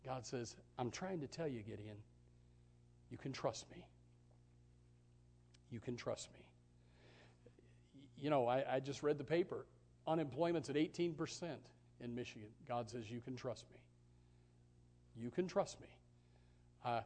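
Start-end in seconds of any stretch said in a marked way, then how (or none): 0.8-6.4 soft voice of God. Acted. God's voice in sermon. 13.1-15.9 soft voice of God. Acted. God's reassuring voice.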